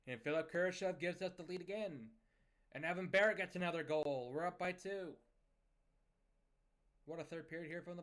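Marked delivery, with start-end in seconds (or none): 0.1-5.1 higher, louder commentary on the goals; thrilled play-by-play, voice lifted; excited goal call. Real, not acted. Calling his team's goals in a game.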